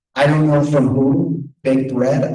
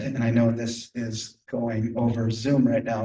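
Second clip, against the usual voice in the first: ordinary delivery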